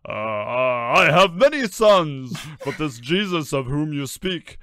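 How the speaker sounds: big booming god voice, a touch puzzled; booming, incredulous. A narrator's reading. Voice from the storm doubting Judas, booming and incredulous. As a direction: boom it out with an air of incredulity. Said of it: "boomed back now with an almost an air of incredulity".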